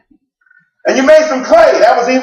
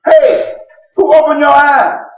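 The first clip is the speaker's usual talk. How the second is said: demanding challenge; 7 semitones higher, 4 dB louder, slower than usual. Acted. Religious leaders questioning healed man.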